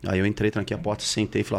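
ordinary talk

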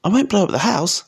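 put-on high-pitched boy's voice; high, earnest child character; protesting boy. A narrator's reading.

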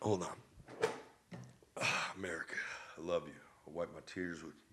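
hushed, low emotional aside; low, soft mock-tearful tribute; choked-up. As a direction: play a little teary, very softly. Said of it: "Sorry, I got a little emotional".

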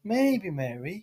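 drawn-out slow sing-song tease; slow, quiet, teasing Maybe, Mary; slow teasing taunt. Acted. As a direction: stretch Maybe out slowly to wind her up. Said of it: "Calm down".

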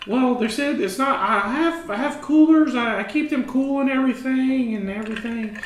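high, loud, put-on penguin dealer voice; sheepish penguin smuggler in a squeaky put-on voice; high character voice. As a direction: play the shady penguin salesman way up high. Back in high Ricky voice.

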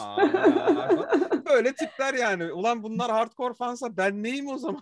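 breaking into high laughter; laughing. Real, not acted. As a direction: laugh through the words. Laughing at the silly fans.

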